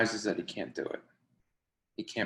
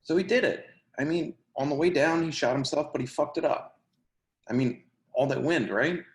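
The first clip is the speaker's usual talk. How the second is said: eager, worked-up telling; 5 semitones higher, 4 dB louder than usual. Acted. Eager to finish the story.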